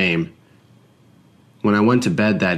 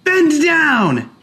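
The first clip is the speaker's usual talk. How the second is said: put-on high, slow, loud child's command; shrill playground order to kneel; bossy child; 5 dB louder than usual. A narrator's reading. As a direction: play a bratty boy ordering an alien. Child demanding.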